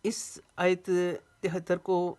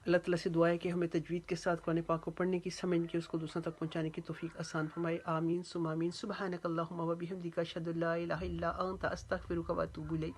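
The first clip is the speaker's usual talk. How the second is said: closing prayer; 4 dB quieter than usual. Real, not acted. Teacher closing the lesson in prayer, soft quick recited dua. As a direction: murmur the familiar prayer through to the salaam.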